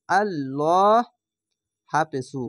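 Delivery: ordinary talk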